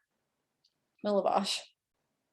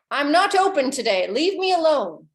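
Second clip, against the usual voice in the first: put-on old carpenter; 5 semitones higher, 6 dB louder than usual. Gruff old carpenter turns them away.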